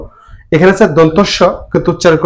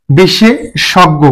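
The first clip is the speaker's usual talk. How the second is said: slow model word; much slower than usual. Shown as a demonstration. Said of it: "teacher models slowly".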